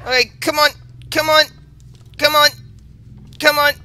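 high, tense urging; will it to work, fast; urgent pleading. Real, not acted. Urging escape.

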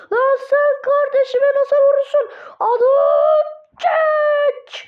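high loud furious yell; angry outburst. Real, not acted. Player furious that someone hit his brother, loud angry yell. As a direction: shout in outrage at the attacker.

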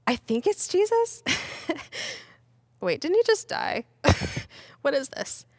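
gasp, high giddy disbelief; nervous laughs between astonished questions; scared but happy. Acted. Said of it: "scared and now kinda happy".

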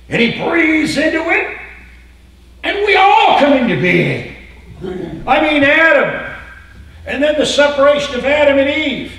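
high, loud, fervent sermon; preaching at full force. Acted. Pastor proclaiming creation to his congregation.

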